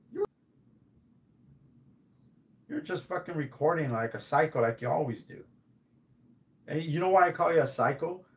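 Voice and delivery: usual speaking voice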